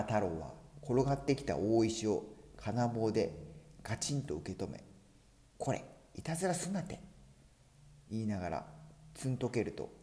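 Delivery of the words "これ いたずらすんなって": high, quick scolding; scolding hero. Acted. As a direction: tell off a prankster briskly. Hero scolds stone roller.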